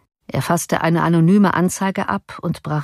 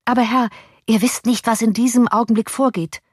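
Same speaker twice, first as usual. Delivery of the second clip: higher, eager servant's voice; 6 semitones higher than usual. A narrator's reading. Servant eager to tell his master the news, higher voice. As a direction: lift the voice, keen to tell the news.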